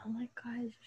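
whispered oh my gosh. Retold or imitated. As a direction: whisper it in shock. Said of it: "They were like".

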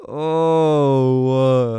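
long loud groan; exasperated groan. Real, not acted. Host fed up with their own mistake, exasperated groan. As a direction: let out a drawn-out, loud oh.

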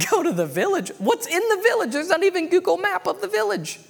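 high-pitched comic disbelief; incredulous questioning. Retold or imitated. Man answering the Lord's order, incredulous protest. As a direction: balk at the order, voice leaping up. Acts out man's reaction to command.

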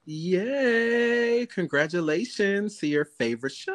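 high, drawn-out yay; playful cheer. Real, not acted. Co-host congratulating a friend's favorite show, high playful cheer. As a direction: cheer sing-song for a friend.

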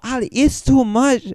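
high mock Indian accent, mother scolding. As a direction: play the fed-up Indian mom in a mock accent. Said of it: "using this racist accent".

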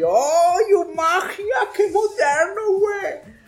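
high, excited gasp of wonder; amazed kid. Retold or imitated. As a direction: squeal like a kid seeing magic. Kid amazed by color-changing cups.

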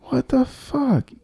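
slow, stunned whisper; mind blown. Real, not acted. Reader stunned by the spelling of jail, mind blown. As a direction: whisper it slowly in utter disbelief. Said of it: "audio my mind being blown".

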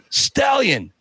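high ringing taunt of Stallion; taunting call. Retold or imitated. Apollo Creed taunts Rocky across the room, high challenging call.

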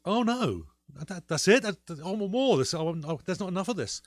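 high, loud, spluttering protest; mock dismay. Viewer reacting to the episode's cliffhanger ending, high-pitched mock dismay. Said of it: "Um, and I felt".

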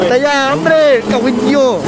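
high, loud exclamation; a sudden high, loud swearing shout. Real, not acted. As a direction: burst out in excitement. Excited outburst during the song.

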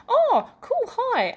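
high, loud, startled oh cool hi; startled hello. Retold or imitated. Retelling meeting a fan in Coles, a startled high squeak. Startled when a stranger recognizes her.

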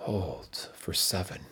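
slow whispered count; hushed coaching whisper. Acted. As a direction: breathe the words out softly. Guided breath hold, whispered.

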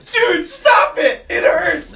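very high, loud squealed protest; drawn-out, shrieked plea; mock pained yell. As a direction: imitate a victim yelling for mercy, laughing. Acting out victim being shot at.

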